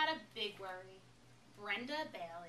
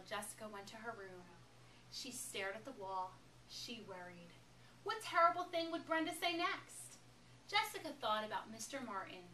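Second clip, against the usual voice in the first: usual speaking voice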